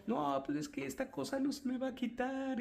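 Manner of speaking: imitated crying, high-pitched whine; tearful complaint. Patient tells therapist it won't go away, tearful. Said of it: "y llorando".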